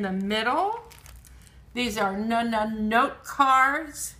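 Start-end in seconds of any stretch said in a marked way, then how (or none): 2.2-3.2 "na na note" with the n sound said twice before the word; first sound repeated. Shown as a demonstration. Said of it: "The na is at the beginning".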